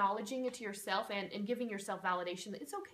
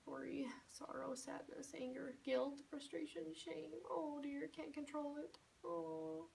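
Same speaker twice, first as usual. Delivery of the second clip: hushed higher despair; 6 semitones higher, 9 dB quieter, slower than usual. Shown as a demonstration. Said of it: "keep repeating all of these".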